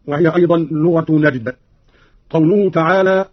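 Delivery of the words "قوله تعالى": ordinary talk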